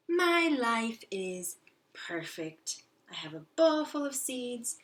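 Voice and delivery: high, lively little hamster; lightly voiced cheerful hamster. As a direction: read it as a proud little hamster. Teacher voices hamster narrator.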